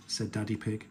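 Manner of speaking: plain reading voice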